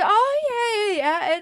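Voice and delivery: half-hearted. Retold or imitated. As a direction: wave them off without interest. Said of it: "a half-hearted, like, wrap-up".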